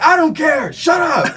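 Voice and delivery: high, loud imitation of a heckler; mock angry shout. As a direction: yell it dismissively like the irate man. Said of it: "he's just shouting".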